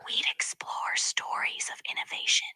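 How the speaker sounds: soft unvoiced whisper. AI asked to whisper the rest, whispering. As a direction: whisper it like ASMR. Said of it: "Can you whisper the rest of it?".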